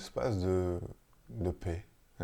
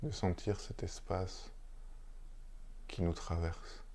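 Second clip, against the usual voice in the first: unhurried, hushed meditation guidance with long silences; guided meditation; 7 dB quieter than usual. Real, not acted. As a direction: lead listeners gently into stillness, phrase by phrase. Guide une méditation après la lecture. (méditation guidée, posée, entre de longs silences)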